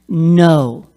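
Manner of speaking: loud, drawn-out parental no; firm refusal. Shown as a demonstration. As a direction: demonstrate a firm NO to a kid. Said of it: "Practice. Look in the mirror".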